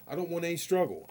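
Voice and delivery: ordinary talk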